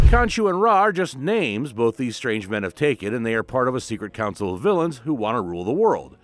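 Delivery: ordinary talk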